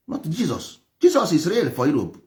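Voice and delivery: ordinary talk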